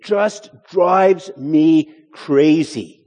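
slow, high, emphatic exasperation; acted fed-up complaint, word by word; exasperated. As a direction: draw out each word in exasperation. Voicing an exasperated objector.

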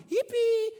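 high mock yippee of joy; happy cheer. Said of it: "they were happy".

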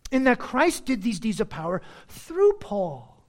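ordinary delivery

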